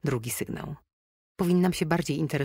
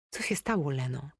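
softer, gently asked; gentle question; 4 dB quieter than usual. A narrator's reading. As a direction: ask softly, to soothe her. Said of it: "zapytałam łagodnie". (łagodnie, cicho)

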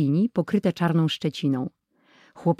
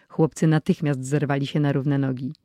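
usual speaking voice

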